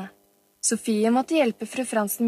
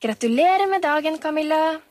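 cheerful shout; 6 semitones higher, 5 dB louder than usual. A narrator's reading. Sebastian surprises Camilla on her birthday, cheerful shout. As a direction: call the greeting out jovially. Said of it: "ropte en munter stemme".

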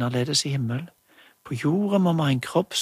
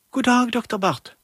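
higher, louder calling hallo; playing mother; 9 semitones higher, 4 dB louder than usual. Julie playing mum calls the doctor, high and loud. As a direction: call into the toy phone like a mum. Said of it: "Det var Julie som snakket for mammen".

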